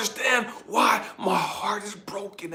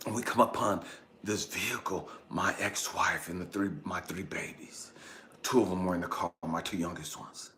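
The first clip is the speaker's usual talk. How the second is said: low, soft, reverent narration; hushed storytelling; 13 semitones lower, 10 dB quieter than usual. A narrator's reading. Telling how he finds his babies in the car, hushed. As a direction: quietly unfold the vision.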